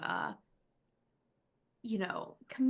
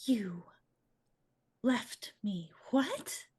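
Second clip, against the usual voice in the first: slow, near-whispered threat; menacing question; slower than usual. Acted. Shriek confronts the one who left hot water, slow menace. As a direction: draw it out slowly, half whispered. Game master voices angry Shriek confronting player.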